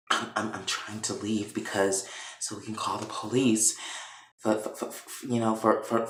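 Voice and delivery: hushed, halting, stuck on words; imitated nervous stammer. Veronica explaining herself to Catherine, mock nervous stammer. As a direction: stammer out a timid excuse under your breath.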